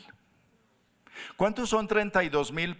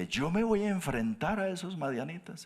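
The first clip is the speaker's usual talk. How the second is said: higher-pitched mild disbelief; incredulous Gideon; 5 semitones higher than usual. Acted. Gideon answering God's daunting challenge, incredulous. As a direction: question the order, a little incredulous. Gedeón duda ante el reto.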